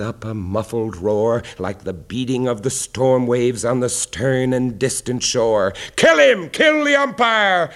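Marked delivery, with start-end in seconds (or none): 6.0-7.7 high, loud furious yell from the stands; angry shout. A narrator's reading. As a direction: scream it like an enraged fan. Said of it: "shouted someone on the stand".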